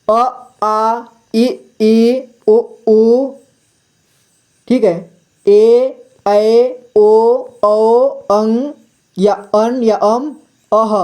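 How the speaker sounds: vowels called out long and slow; drilled vowel recitation. Shown as a demonstration. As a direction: stretch each vowel like a classroom drill. (बच्चों को स्वर रटवाते हुए, धीरे और खींचकर)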